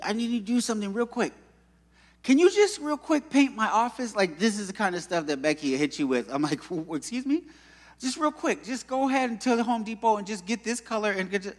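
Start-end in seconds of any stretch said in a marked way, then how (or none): 0.0-4.2 high, fast pushy-coworker impression; imitating Becky. Coworker asking to paint the office real quick, high mock urgency. Acting out Becky springing a request. 6.7-7.4 high-pitched startled double take; mock disbelief. Speaker reacting to being asked to paint, mock startled. 8.0-11.6 high, hurried coaxing coworker; imitating Becky. Coworker sending him to Home Depot, high breezy rush. Becky brushing off his objection.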